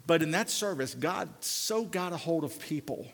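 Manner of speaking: normal talking voice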